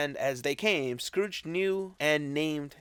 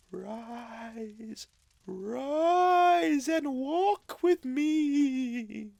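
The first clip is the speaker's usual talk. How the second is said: high, slow, otherworldly spirit; ghostly spirit voice; 11 semitones higher, much slower than usual. Acted. Ghost of Christmas Past solemnly summoning Scrooge, high and slow. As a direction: speak from beyond, high and drawn out.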